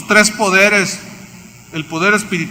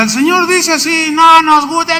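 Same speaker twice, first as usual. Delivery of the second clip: mocking high voice; 11 semitones higher, 4 dB louder than usual. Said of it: "Y iba y les decía".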